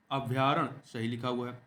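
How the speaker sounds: normal talking voice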